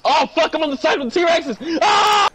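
high, loud yelling; panicked shout. Real, not acted. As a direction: yell in panic. Caught by the T-Rex.

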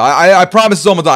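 fast, loud, stumbling reassurance; hurried promise. Real, not acted. Streamer holding up the game to fix the stream, hurriedly promising. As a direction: rush it out to buy a moment.

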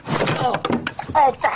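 sudden high shriek as it spills; startled high shriek. Acted. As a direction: yelp high and loud in shock. Jam spills over her.

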